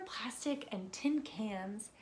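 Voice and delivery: ordinary talk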